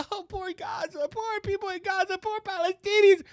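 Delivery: high, soft sarcastic whimpering; mock pity. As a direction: croon it in mocking sympathy.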